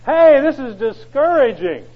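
high, loud, slow groan of frustration; mock discouraged churchgoer. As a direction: whine it like a weary member. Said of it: "and you say".

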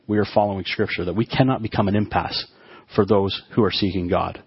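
usual speaking voice

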